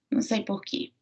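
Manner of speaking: ordinary talk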